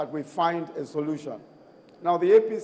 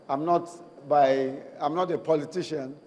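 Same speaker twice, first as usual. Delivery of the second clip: ordinary talk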